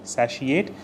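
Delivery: "Satiate" said sa-she-ate; pronounced with SHE. Shown as a demonstration. Teacher repeating the model for learners, "Satiate" with a she sound. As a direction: put a "she" in the middle of "Satiate". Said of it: "is how you pronounce this".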